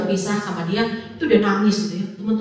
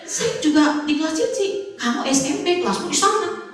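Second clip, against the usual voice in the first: high-pitched retold squabble; lightly acted, higher-voiced protest; acted bickering; 7 semitones higher than usual. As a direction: play a pupil and teacher arguing over the classroom. Acted argument with clingy pupil.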